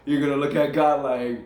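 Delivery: usual speaking voice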